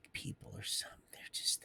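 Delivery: hushed, unvoiced whisper; soft secretive whisper. Real, not acted. Trailing whispered aside.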